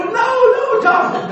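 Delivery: yelling across the set to stop the taping; alarmed stop. Retold or imitated. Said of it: "and I go".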